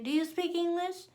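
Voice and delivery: high mimicked question; imitated rude questioning; rude stranger. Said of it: "That's rude".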